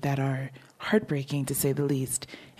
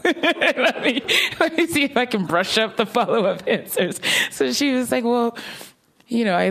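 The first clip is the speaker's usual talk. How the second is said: laughing through the words; giggling as she talks; 8 semitones higher, 4 dB louder than usual. Real, not acted. Laughing while recounting awkward moment.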